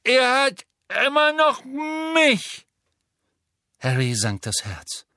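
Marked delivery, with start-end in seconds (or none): 0.0-2.6 put-on much higher, louder, slow boyish call; Neville calling out. A narrator's reading. Neville calling down to Harry, brave boyish cry.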